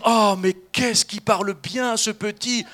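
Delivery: loud, slow, mock-marvelling gush; amazed parent. Parents admiring their toddler's talk, mock amazement. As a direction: gush proudly, drawing out the wonder. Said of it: "vos parents étaient émerveillés devant vous".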